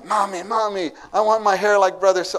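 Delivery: put-on little kid begging his mommy for a haircut; child begging mommy. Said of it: "the kid went to mommy".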